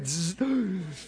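buzzing zap noise; playful electric buzz; imitated zap. Preacher joking about the Lord's jolt, buzzing.

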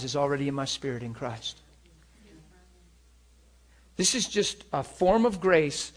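normal talking voice

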